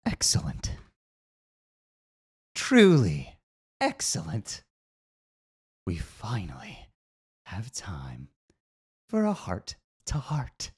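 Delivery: a lightly voiced eerie stranger; sinister figure. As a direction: purr it like a hidden schemer. Mysterious figure speaks.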